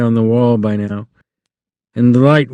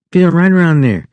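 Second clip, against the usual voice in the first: wife's coaxing voice; 6 semitones higher, 3 dB louder than usual. A narrator's reading. Wife invites Lenny to feel.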